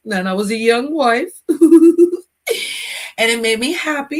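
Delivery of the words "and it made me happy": slow, high words through a laugh. Real, not acted. Remembering old school music with viewers, laughing happily. Recalling happy memories, laughing.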